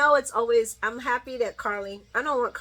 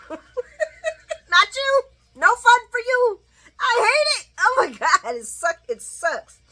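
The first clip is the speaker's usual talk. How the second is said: laughing mock rant; 13 semitones higher, 7 dB louder, slower than usual. Replaying Michael's line, cracking up.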